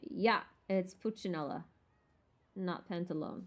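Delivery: ordinary talk, nothing marked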